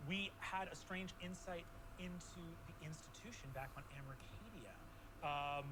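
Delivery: low, measured storytelling; game master recap. Acted. GM recaps open questions.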